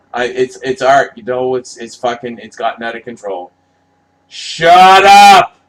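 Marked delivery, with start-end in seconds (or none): 4.3-5.6 much higher and louder, full-throated yell; furious shout. Real, not acted. Fan screaming at the poster credits, furious shout. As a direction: scream it at the credits in real fury.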